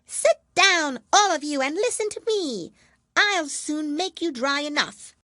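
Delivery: high, loud squeaky mouse voice; a self-important little mouse commanding; mouse calling out. A narrator's reading. Mouse calls out to the wet animals, high and loud. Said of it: "called out".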